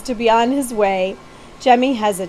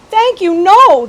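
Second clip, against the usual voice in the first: a sharp, louder refusal; exclaimed; 9 semitones higher, 10 dB louder, slower than usual. A narrator's reading. Prince refusing Betsy's help, a loud high exclaimed no. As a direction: cut her off, high and loud. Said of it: "the prince exclaimed".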